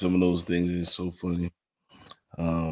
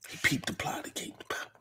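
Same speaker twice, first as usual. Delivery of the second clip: rhythmic hushed rhyme; whisper; 11 dB quieter than usual. Acted.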